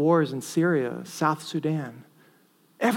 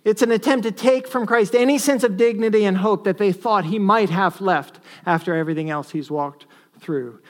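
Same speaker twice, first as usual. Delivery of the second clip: normal talking voice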